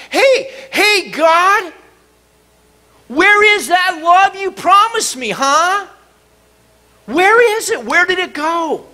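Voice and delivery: high, loud, angry yell at God. Retold or imitated. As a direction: shout your complaint up to heaven. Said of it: "I actually yelled at him".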